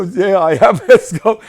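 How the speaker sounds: high, loud reply through laughter; laughing reply. Real, not acted. [name] insisting to Escobar while laughing, high and loud.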